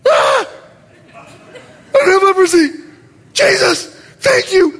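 high, loud, laughing shout of thanks; ecstatic joy. Retold or imitated. Healed leper shouts his thanks to Jesus in laughing joy. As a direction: burst out in overjoyed laughter and yelling. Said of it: "imagine the inexpressible joy".

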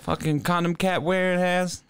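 hammed-up roast, voice pushed high. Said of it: "He goes".

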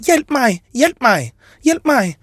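high-pitched little pleas, each falling away; ant's cry for help. Acted. Wounded ant signalling its nestmates, high mock cry for help. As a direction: squeal it like a tiny creature in trouble. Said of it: "skiller den ut et hormon som sier".

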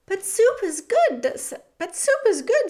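high stammering insistence; pleading, tripping over the words; excited stammer. Acted. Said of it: "said Despereaux".

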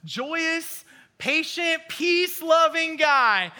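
high, slow, swelling admiration; building in volume, hammed-up awe; exaggerated praise. Real, not acted. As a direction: stretch out the praise. Admiring the calm student.

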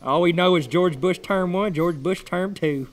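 hammed-up Texas good-old-boy rant; exaggerated Texan twang, playing dumb. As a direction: put on a big rambling Texan accent.

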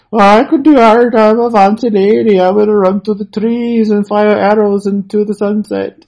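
high, dreamy fancy lady. Retold or imitated. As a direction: gush like a posh dreamer. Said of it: "and you're all like".

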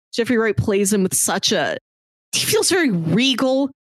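normal talking voice

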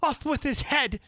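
high, loud royal bellow; bawling. A narrator's reading. The Queen bawling at the Hatter, loud and high. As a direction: bawl it out like a furious queen. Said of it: "bawled out".

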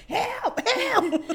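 high, loud comic yelp; hammed-up panicked call for help; mock cry for help. Teller acting out being cornered by dogs, mock cry for help. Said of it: "I was like".

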